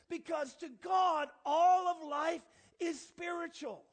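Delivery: loud slow preaching, punching God. Acted. Pastor driving home the point to the congregation, loud and slow. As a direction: preach it out to the church with force.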